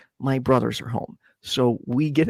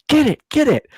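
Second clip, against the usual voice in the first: mock yell; 10 semitones higher, 4 dB louder than usual. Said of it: "college kids yelling".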